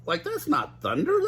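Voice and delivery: high moaning Shaggy. Acted. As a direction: moan it as Shaggy. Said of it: "Shaggy moaned".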